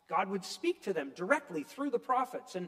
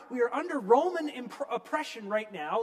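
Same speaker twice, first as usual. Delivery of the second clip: normal talking voice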